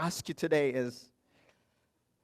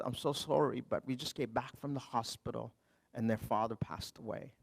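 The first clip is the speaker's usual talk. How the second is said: much softer, hushed and grieving; quiet sorrow; 5 semitones lower, 10 dB quieter than usual. Retold or imitated. Grieving mother apologizing to the stranger on the train, hushed and sad. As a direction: let it drop to a hush of loss.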